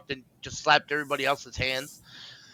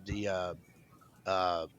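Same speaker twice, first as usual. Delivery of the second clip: normal talking voice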